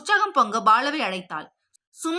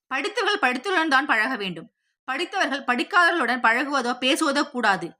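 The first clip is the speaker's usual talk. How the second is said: ordinary delivery, nothing marked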